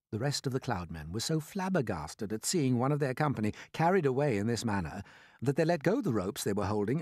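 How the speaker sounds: ordinary delivery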